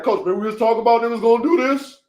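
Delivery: fast, eager student voice, lightly put on; imitating Reddy. Coach imitating his student Reddy reminding him, fast and eager. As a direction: rattle it off like an excited student. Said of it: "He's like".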